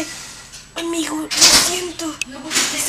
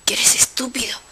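whispered insult; 6 semitones lower, 4 dB quieter than usual. Acted. Insulting the crying character.

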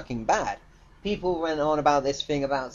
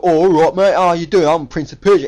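loud, mock English thug accent; Jake Gyllenhaal; 9 dB louder than usual. Reviewer mocking the lead actor's English accent, loud thuggish impression. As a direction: boom it out like a loutish English thug. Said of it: "He sounded like a fucking thug".

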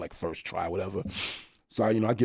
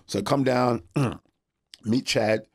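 usual speaking voice

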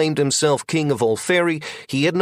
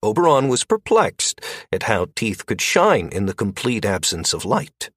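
ordinary delivery, nothing marked

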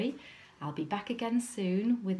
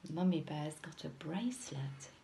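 usual speaking voice

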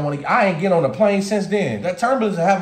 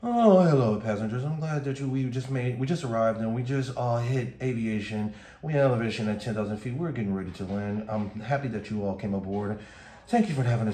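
low, hushed pilot announcement; airline pilot; 11 semitones lower, 6 dB quieter than usual. Acted. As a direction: murmur it deep and even, like a cabin announcement. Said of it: "the pilot gonna tell me something".

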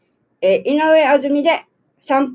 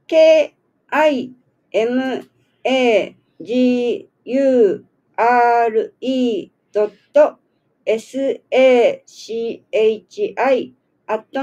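一文字ごとに区切ってゆっくり; 書き取れるようにアルファベットを伸ばして読む; 一字ずつ読み上げ; much slower than usual. Real, not acted. アドレスの綴りを一字ずつ読む. (ゆっくり綴りを読み上げる)

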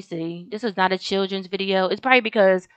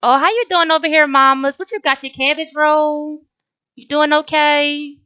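put-on cooing to a child; 7 semitones higher, 6 dB louder than usual. Greeting a little Sim girl.

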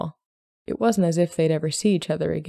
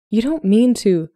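high, loud protest, cut off; scandalized scolding; 5 semitones higher, 6 dB louder than usual. A narrator's reading. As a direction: protest in shock to your mother. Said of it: "scolded in a scandalized whisper".

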